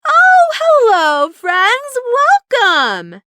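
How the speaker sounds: high, loud cheerful greeting. A narrator's reading. Ollie greets his friends at the door, cheerfully high. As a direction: welcome them brightly, all smiles. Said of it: "said cheerfully".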